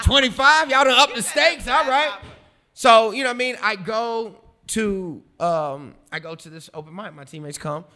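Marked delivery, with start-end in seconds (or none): 0.0-2.2 higher and much louder, cracking up as he quotes himself; laughing through the quote. Retold or imitated. Retelling the twenty-five dollar dare to the host, laughing. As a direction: laugh while you mock-accept the raised bet. Retelling his reply to the bet.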